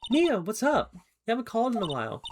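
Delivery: high, bright friend's voice on the phone; perky girl voice. Acted. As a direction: chirp it like an excited friend. Voicing Maya on the phone.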